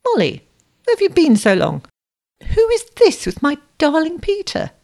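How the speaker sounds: high, imperious questioning; suspicious aunt. A narrator's reading. Aunt eyes her niece's companion, sharply suspicious. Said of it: "his companion eyed her niece suspiciously".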